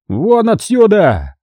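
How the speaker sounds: slow, high, drawn-out roar. Acted. Cardinal orders the guards out, slow high roar. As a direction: bellow them out of the room. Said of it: "взревел он".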